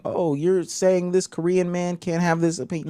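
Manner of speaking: high-pitched mock objection; imagined objector. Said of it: "I can already see people saying".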